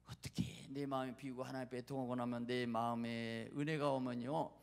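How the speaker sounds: ordinary delivery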